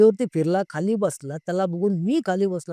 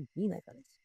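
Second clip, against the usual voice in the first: "ती नाही घालायची" repeated much softer, almost under the breath; quiet repeat; 13 dB quieter than usual. Shown as a demonstration. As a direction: echo "ती नाही घालायची" softly to yourself. ("ती नाही घालायची" हळू आवाजात पुन्हा)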